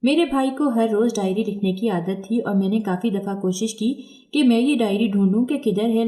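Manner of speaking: ordinary delivery, nothing marked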